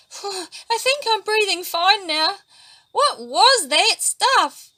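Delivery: high relieved voice. A narrator's reading. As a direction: sigh out the relief. Patiki relieved after escaping.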